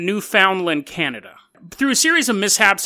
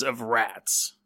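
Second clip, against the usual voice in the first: low, soft ominous drop; 8 semitones lower, 8 dB quieter than usual. A narrator's reading. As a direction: let the last words sink low and soft. Teaser ending on rats.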